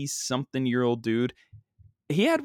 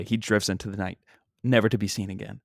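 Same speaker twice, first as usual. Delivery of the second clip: ordinary talk, nothing marked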